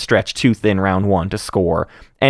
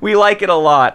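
much higher, cheering with a laugh; giddy relief; 12 semitones higher than usual. Real, not acted. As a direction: celebrate like a long wait finally ended. Cheering that war suns are good.

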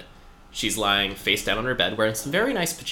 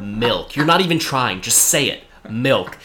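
frustrated coaxing; 5 dB louder than usual. Retold or imitated. Pushing dog to talk.

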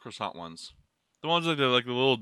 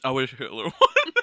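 laughing; 4 dB louder than usual. Real, not acted. Cracks up at his own bad joke.

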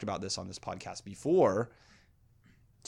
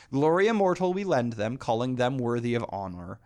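higher, louder declaiming of the verse; rising, full-voiced recital; poem reading; 3 semitones higher, 5 dB louder than usual. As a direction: proclaim the lines like an epic. Reads his own translation aloud.

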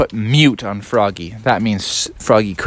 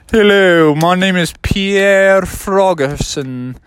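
higher, slow disguised voice; Pierre Frogerson; 5 semitones higher, 4 dB louder, slower than usual. Acted. Froggy in disguise logging in to class, put-on voice. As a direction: introduce yourself grandly in a fake voice.

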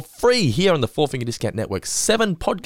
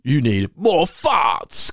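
stretched-out, higher hammed-up character voice; gleeful cartoon voice; 4 semitones higher, much slower than usual. Podcaster mimicking a voice actor relishing the line, gleeful cartoon voice. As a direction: draw it out with glee, in character. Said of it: "hear how happy Trey is to, to say this".

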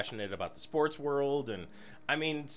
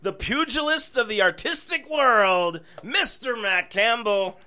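announcer; 5 semitones higher, 7 dB louder than usual. Acted. Announcing guest grandly.

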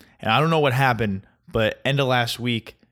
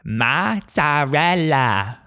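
very slow, higher, hammed-up Italian accent; douchey Italian accent; 5 semitones higher, much slower than usual. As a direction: stretch each syllable with try-hard Italian flourish. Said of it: "put an Italian accent and try super hard".

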